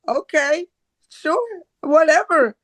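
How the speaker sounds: put-on high, sulky child voice; a reluctant kid shrugging it off; childish voice. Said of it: "my child, the inner child".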